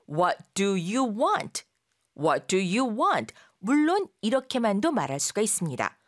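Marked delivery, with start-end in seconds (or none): 0.1-3.3 each word drawn out; slow model. Shown as a demonstration. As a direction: say it slowly twice. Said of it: "문장을 말해볼게요".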